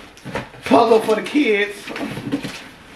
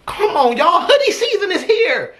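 high-pitched, giddy announcement; thrilled, voice jumping up high; excited whoop; 9 semitones higher than usual. Real, not acted. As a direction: crow it high with a laugh. Celebrating a hoodie gift.

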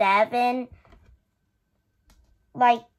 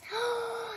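gasp; 10 semitones higher, 12 dB quieter than usual. Real, not acted. Reacting to unboxing surprise.

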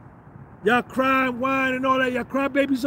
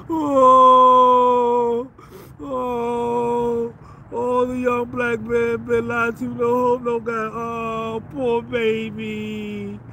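mock whining victim; 3 dB louder, much slower than usual. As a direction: whine like a pitiful crybaby in mockery.

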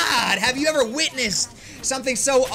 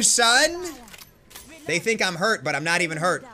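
usual speaking voice

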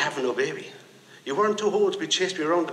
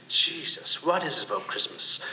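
ordinary talk

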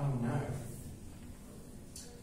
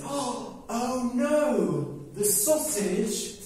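shocked cry; 7 semitones higher, slower than usual. Acted. Bird discovering the dead sausage, high alarmed cry. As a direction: gasp it out as if you just found your friend dead.

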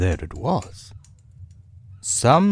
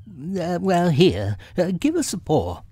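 high, hesitant helper voice; lifted storybook animal, with little hesitations; Rabbit; 11 semitones higher than usual. A narrator's reading. Rabbit offering to pull Pooh out, high voice.

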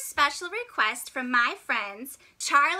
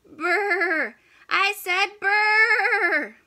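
drawn-out loud brr; shivering brr; 4 dB louder, much slower than usual. Acted. Freezing penguin in dark water, loud slow brr. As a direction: shiver it out slowly.

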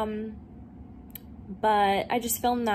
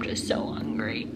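whispering; 12 semitones lower, 4 dB quieter than usual. Real, not acted. Whispered aside to camera.

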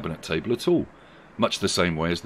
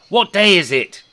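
high, loud call; shouted question; 12 semitones higher, 9 dB louder than usual. A narrator's reading. Scrooge to a boy outside, shouting. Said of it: "Rishi Scrooge shouted to a small boy".

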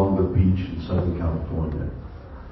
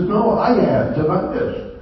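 doctor's comeback, pitched way up; high-pitched acted reply; 12 semitones higher than usual. Doctor answering his patient, high-pitched retold reply. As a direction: jump high for the quoted protest.

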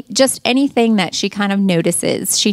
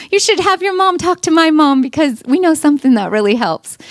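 put-on eager child; 8 semitones higher, 4 dB louder, faster than usual. Mother quotes daughter to friends.

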